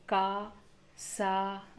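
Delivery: का, सा with the aa drawn out slowly; long aa vowel. Shown as a demonstration. Teacher showing learners the aa vowel sign, long aa in का, सा. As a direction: hold the long open vowel in का, सा. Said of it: "तो का उसका उच्चारण होगा".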